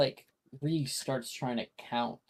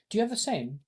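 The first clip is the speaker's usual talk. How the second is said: a hint of an eager schoolgirl; higher schoolgirl voice; 9 semitones higher, faster than usual. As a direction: lift it up into a girl's voice. GM voices Drusilla asking.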